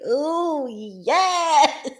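high, loud, drawn-out "ooh, yes"; delighted exclamation. Acted. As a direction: revel in it with a laugh. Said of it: "I just feel like".

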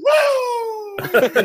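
high, loud drawn-out "woo"; wrestler's whooping catchphrase, belted high; Nature Boy woo. Acted. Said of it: "the Nature Boy woos".

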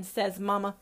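usual speaking voice

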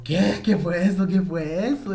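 loud, startled cry; jumpy, loud "what was that". Retold or imitated. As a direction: blurt it out in a fright. Startled by voice in his ear.

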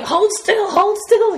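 high, loud bossy nurse voice; mock nurse. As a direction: imitate the nurse barking orders. Nurse holding her down.

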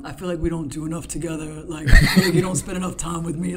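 mock needy friend, laughing at the end; whiny clingy buddy, half-laughing. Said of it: "I just acted like a needy friend".

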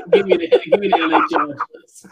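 laughing, high and loud, through the words. Real, not acted. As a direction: crack up while saying the pick. Laughing through his pick.

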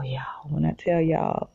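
whispered in awe, out of breath; breathless whisper. Real, not acted. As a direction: breathe it out, overwhelmed. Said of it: "I feel like I just lost my breath".

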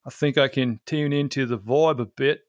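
put-on Aussie accent; Australian accent. Shown as a demonstration. Podcast guest testing an Aussie accent on an Australian, put-on Australian accent. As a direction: imitate a broad Australian accent. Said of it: "I can do an Australian accent".

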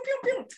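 very high, playful pew-pew; cartoonish high-pitched spurts; squirting noises. Shown as a demonstration. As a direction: imitate little squirts in a squeaky pitch. Imitates squirts of love drugs.

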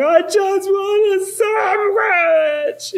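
high, slow crying whine, hammed up; mock sobbing wail. Father imitates sobbing daughter wanting a sandwich, high crying wail. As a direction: wail it through tears like a toddler in a tantrum.

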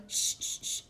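soft repeated shushing noise; hushing. Acted. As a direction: hush softly to listen. Child listening at the wall. (siseo suave pidiendo silencio)

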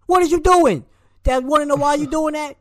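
high, loud disbelieving yell; exasperated yell. Real, not acted. Fan yelling at the coach in disbelief. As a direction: throw up your hands and yell.

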